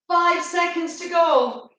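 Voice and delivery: high loud whooping countdown; excited cheer. Real, not acted. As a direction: cheer them through the end.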